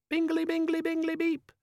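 very high, bright chirpy beeping; cheerful gadget voice. Acted. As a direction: chirp it like a happy little device. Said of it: "said a cheerful, if somewhat muffled, voice".

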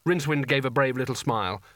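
usual speaking voice

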